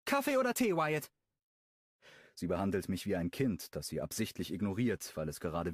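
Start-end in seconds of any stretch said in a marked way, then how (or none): 0.0-1.1 higher, quick, sugary-sweet female character; Brooklyn's voice. Acted. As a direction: offer the coffee in a sweet, fast voice. (hohe, süßliche Frauenstimme)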